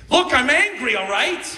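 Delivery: loud, high "Look, I'm angry"; angry declaration. Shown as a demonstration. Preacher demonstrating an angry statement, loud and high. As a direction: announce anger forcefully. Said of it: "if I were to say".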